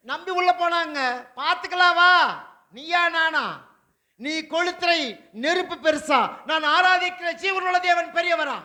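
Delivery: loud, climbing sermon proclamation; fiery preaching. Acted. Pastor thundering to the congregation about the furnace, loud and fervent. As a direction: preach it out with rising fire.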